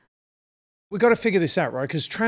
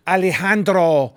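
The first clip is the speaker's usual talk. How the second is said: put-on Spanish accent; slower than usual. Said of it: "they'll do that accent".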